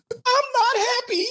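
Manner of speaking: very high, laughing through a cry. Real, not acted. Streamer laugh-crying to chat about his ruined life. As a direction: break into a laughing sob.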